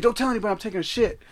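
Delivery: high, fast mock plea; Smokey. Acts out Smokey asking for secrecy.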